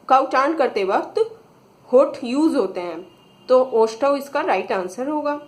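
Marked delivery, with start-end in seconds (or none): none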